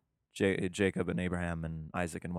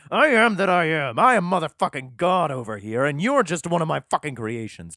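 much higher and louder ranting; mad God; 8 semitones higher, 8 dB louder than usual. Acted. God yelling at Moses, hammed-up fury. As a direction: rant like a furious deity. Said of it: "he gets kinda mad".

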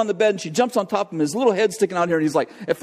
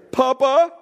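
put-on much higher small boy's call; a pinned boy calling for grandpa; little boy calling; 9 semitones higher, 4 dB louder than usual. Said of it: "I hear".

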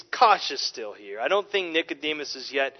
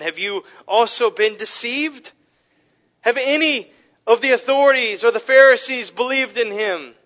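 a touch of haughty Pharisees, higher and louder; scornful Pharisees; 7 semitones higher, 8 dB louder than usual. Retold or imitated. Pharisees rebuking the officers, mildly scornful challenge. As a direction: lightly play the sneering leaders. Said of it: "The Pharisees answered".

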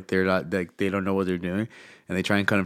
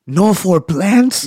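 much higher, louder and very slow, stretched in disbelief; baffled disbelief; 12 semitones higher, 12 dB louder, much slower than usual. Retold or imitated. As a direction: drag out the question, stunned. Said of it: "looks at me baffled and he goes".

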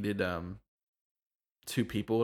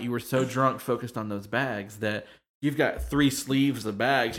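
ordinary talk, nothing marked

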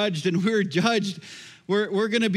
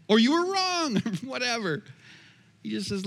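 usual speaking voice

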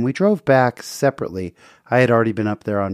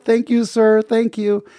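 high, mock yell from a distance; retold yell; 10 semitones higher, slower than usual. Girlfriend in the car thanks the stranger, calling out high. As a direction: call out thanks from inside the car. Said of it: "his girlfriend from inside the car yelled".

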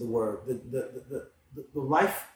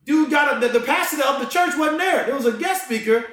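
much higher and louder, preaching with fire; rising into a full excited preach; fired up; 12 semitones higher, 8 dB louder than usual. Real, not acted. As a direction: tell it like you're lighting up the room. Fired-up retelling of Spurgeon story.

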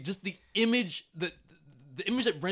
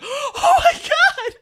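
high, slow, loud drawn-out gasp of shock; mock shocked shriek; 13 dB louder, much slower than usual. Retelling his reaction to the movie, mock shriek. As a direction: stretch it out in a loud, high squeal. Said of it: "I was like".

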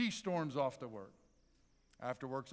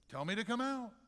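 higher-pitched, pushy demand; quick high-pitched demand, acted; 7 semitones higher than usual. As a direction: demand it as Peter, insistently. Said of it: "maybe there's a little bit of demand there".

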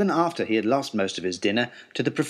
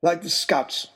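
a higher, lightly voiced pompous father; father's voice; 4 semitones higher, slower than usual. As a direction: play the father proudly quoting his motto. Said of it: "is what he said".

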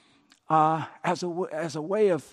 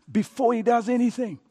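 ordinary talk

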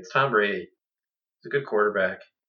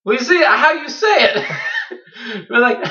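loud, high laugh through the words; cracking up while pointing it out; 12 semitones higher, 7 dB louder than usual. Real, not acted. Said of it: "laugh shout".

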